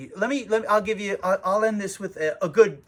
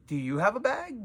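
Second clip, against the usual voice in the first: higher-pitched, pointed echo of the question; question thrown back; 6 semitones higher than usual. Retold or imitated. Shop owner throws customer's bag question back, pointedly. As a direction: turn the question back on the asker.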